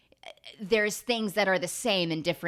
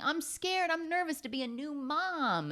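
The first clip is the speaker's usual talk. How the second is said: high, small mock-frightened voice; scared new mom; 11 semitones higher, 3 dB quieter than usual. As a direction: squeak it out like a nervous mother-to-be. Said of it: "And she's like".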